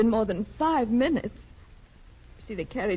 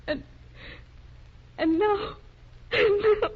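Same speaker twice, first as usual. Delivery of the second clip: breaking into sobs; 9 semitones higher than usual. Acted. Wife breaking down in tears to the doctor. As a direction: sob the words, losing control.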